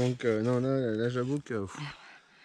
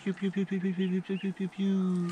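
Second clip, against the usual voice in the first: high calling; 7 semitones higher, slower than usual. Real, not acted. Calling the dog up the steps.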